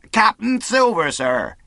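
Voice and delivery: high, loud formal hail; shouting. A narrator's reading. Silver's man asks to come on board, shouting. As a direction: shout the message across the stockade. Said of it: "he shouted".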